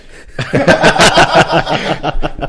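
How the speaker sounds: loud, high burst of laughter; real belly laugh at a friend's innocence; hearty laugh. Real, not acted. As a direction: let a big laugh explode out.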